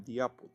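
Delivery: "the apple" with "the" said as "di"; "the apple" sounds like "di apol"; English pronunciation demo. Shown as a demonstration. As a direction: say "the" as "thee" before "apple". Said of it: "En este caso suena como the".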